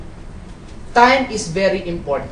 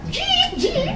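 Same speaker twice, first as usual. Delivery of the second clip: jeep horn; 3 dB quieter than usual. Retold or imitated. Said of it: "Pababa yan".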